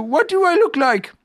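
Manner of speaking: high, loud bear call; Pooh shouting down. A narrator's reading. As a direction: call the question down from a height. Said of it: "shouted Winnie the Pooh down to you".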